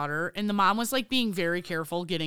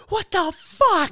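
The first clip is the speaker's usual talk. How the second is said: higher, louder, slow outraged what the fuck; loud outraged yell; 10 semitones higher, 8 dB louder, slower than usual. Retold or imitated. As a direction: yell it at the careless driver, drawn out. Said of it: "so loud in my car".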